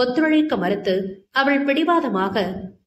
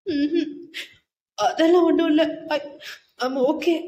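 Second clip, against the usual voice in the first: higher-pitched, tearful and halting; small sob; 4 semitones higher than usual. A narrator's reading. Wife assuring husband she is okay, sobbing slightly. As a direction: insist while holding back sobs. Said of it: "என்றாள் சிறு கேவலுடன்".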